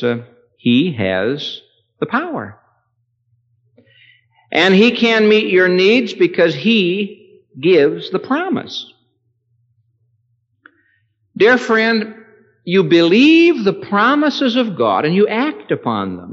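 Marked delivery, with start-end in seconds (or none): 2.0-8.9 high, loud preaching swell; rising pulpit fervor; preaching peak. Acted. 11.4-16.3 high, loud, urgent appeal; full-force sermon appeal; fervent preaching. Acted. Direct appeal from pulpit.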